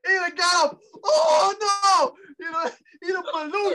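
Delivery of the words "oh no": high, loud cry of playful alarm; hammed-up yell at a fight kicking off; mock alarmed yell. Friend re-enacting a scuffle, mock alarmed yell.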